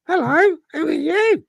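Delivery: tiny high squeaky mongoose voice; Jeff's little voice. Acted. Talking mongoose greets the investigator in a tiny high voice. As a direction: pitch it way up like a small talking animal. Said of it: "this little voice".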